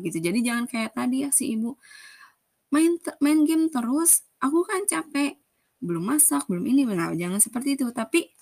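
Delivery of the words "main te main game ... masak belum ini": higher, whiny mock complaint; complaining nag. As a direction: whine it like a fed-up wife. Wife's nagging, the bad example.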